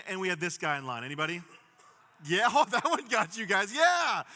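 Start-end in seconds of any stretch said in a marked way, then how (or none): 2.3-4.2 laughing, higher and louder. Real, not acted. Pastor laughs as the crowd groans at the shopper, loud laughing. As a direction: laugh along with the crowd's reaction. Crowd reacts loudly to picture.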